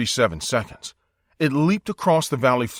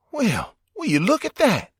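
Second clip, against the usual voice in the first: lightly voiced awed Wyatt; 11 semitones higher than usual. A narrator's reading. Wyatt pointing at the ditch, amazed. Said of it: "Wyatt said".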